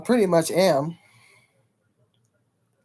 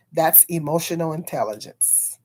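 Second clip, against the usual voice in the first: ordinary talk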